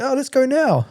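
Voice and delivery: very high mock voice, urging; keen dealer. Camo guy urging the narrator to leave now, in a very high mock voice. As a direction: pitch it way up and push to leave now.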